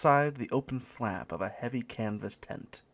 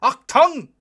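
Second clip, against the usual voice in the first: high, loud German bark; screaming; 13 dB louder than usual. A narrator's reading. German usher from the doorway, high loud scream. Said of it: "screamed the German from the doorway".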